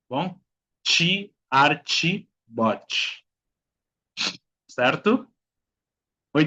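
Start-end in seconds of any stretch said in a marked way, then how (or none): 0.8-3.2 "Chi, archi, bochi" each with ch; final TE as chi. Shown as a demonstration. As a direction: drill "Chi, archi, bochi", falling on the last. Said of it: "T más E al final de la palabra". ("Chi, archi, bochi" terminando en sonido chi)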